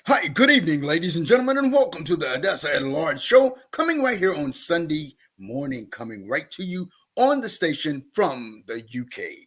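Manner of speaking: polished radio host announcing the show; smooth on-air presenter voice. Shown as a demonstration. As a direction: switch on a broadcaster's welcome. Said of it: "switch over and have a radio voice".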